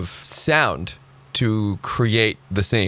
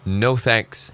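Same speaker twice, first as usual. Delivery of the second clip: usual speaking voice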